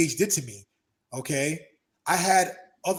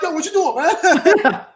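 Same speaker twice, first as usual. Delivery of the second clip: much higher, louder call through laughter; laughing confrontation; 13 semitones higher, 11 dB louder, slower than usual. Retold or imitated. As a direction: laugh while challenging him. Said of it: "they're like".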